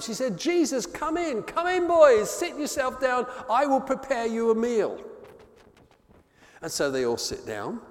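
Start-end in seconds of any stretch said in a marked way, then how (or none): 0.4-5.0 higher, bright welcoming hostess; hospitable Martha. Acted. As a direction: fuss them in the door like a busy host. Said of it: "being the very hospitable type".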